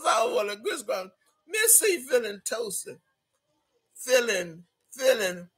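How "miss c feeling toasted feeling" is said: normal talking voice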